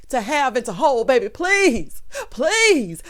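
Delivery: high, loud hammed-up cry; mock wailing. Viewer mock crying over the wedding scene. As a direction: wail please like a mock sob.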